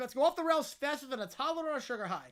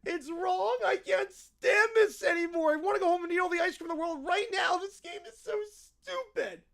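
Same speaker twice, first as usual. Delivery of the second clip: hammed-up bratty kid tantrum; tantrum meltdown; 3 semitones higher, 3 dB louder than usual. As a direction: melt down like a spoiled child. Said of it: "we've reached our limit".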